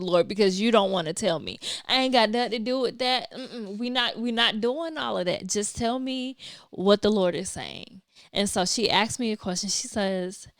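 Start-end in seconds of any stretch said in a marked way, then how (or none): none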